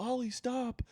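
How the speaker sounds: high, soft mock-pleading voice; imitated foster mother. Acts the mother scolding Ollie.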